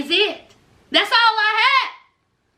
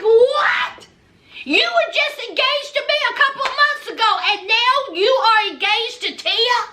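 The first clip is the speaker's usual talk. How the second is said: shocked outburst; 5 semitones higher than usual. Retold or imitated. Jilted ex shocked by Nicole's engagement, high and rushed. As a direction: blurt it out high, then rush on.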